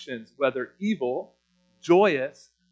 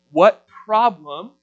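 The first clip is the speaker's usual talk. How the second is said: ordinary talk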